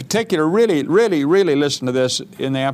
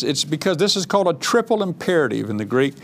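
ordinary talk

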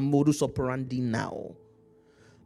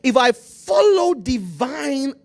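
fiery preaching; 4 semitones higher, 5 dB louder than usual. Acted. Preaching at full force.

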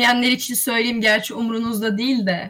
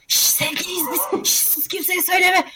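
secretive hushed lovers; whispering while hiding; 6 semitones higher than usual. Pretend. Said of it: "ikimiz fısıldayarak böyle".

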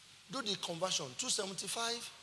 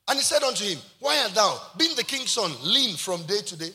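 loud, projected Bible reading; scripture reading; 3 semitones higher, 8 dB louder than usual. Real, not acted. Preacher reading Jonadab's question to the congregation, loud scripture reading. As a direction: read the verse out to the whole hall.

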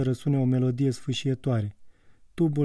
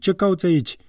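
"ce" barked out higher and louder, then falling; loud, higher barked challenge; barked shout; 6 semitones higher, 6 dB louder than usual. A narrator's reading. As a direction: snarl "ce cauți aici" like a guard dog. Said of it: "lătră".

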